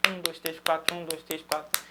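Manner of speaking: conteggio pulsato. Shown as a demonstration. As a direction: count small notes evenly without stopping. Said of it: "pulsa em quatro notas pequenas".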